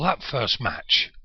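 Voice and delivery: ordinary delivery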